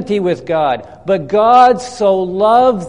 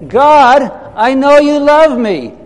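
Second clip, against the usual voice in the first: raised, high and loud call for all to repeat; loud group declaration; 6 semitones higher, 6 dB louder, slower than usual. Shown as a demonstration. Pastor leading the congregation in a loud declaration. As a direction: lead the room in saying it loudly.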